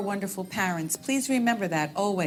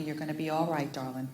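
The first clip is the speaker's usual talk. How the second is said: tender reassurance; 5 semitones lower, 6 dB quieter, faster than usual. Retold or imitated. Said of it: "she keeps telling me".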